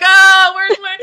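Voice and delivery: high, loud flustered search; frantic searching. Pretend. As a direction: sound frantic hunting for notes. Searching for notes in panic.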